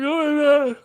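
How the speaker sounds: high mock imitation of other people; mimicking people talking. Mimics how people start talking.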